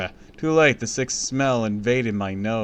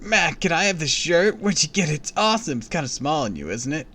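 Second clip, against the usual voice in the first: put-on higher, bubbly pushy kid; eager kid voice; 6 semitones higher than usual. A narrator's reading. Pushy neighbor kid begging Matt for his shirt, higher. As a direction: gush over the shirt.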